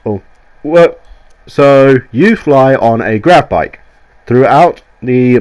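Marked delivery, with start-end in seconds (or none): none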